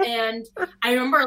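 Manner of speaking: usual speaking voice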